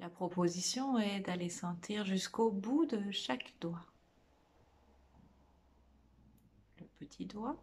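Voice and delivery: guided meditation. Real, not acted. Meditation teacher guiding listeners through a body scan, soft guided meditation. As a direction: lead them gently inward, phrase by phrase, leaving lots of space.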